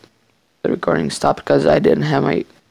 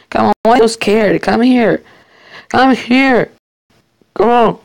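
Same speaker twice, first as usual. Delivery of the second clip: taunting call; 6 semitones higher, 4 dB louder than usual. Real, not acted. Taunting an opponent to come.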